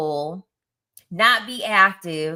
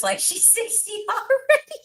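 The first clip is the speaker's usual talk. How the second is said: laughing disbelief; 14 semitones higher than usual. Real, not acted. Laughing at an old-looking schoolmate.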